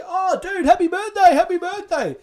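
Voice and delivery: high, cheery birthday shout-out; chirpy buddy calling out; excited greeting. Retold or imitated.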